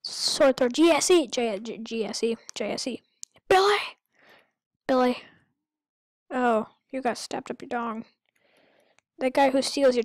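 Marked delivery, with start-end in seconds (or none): 3.5-3.8 yelling a name. Real, not acted. Gamer yelling at the character [name], loud high shout.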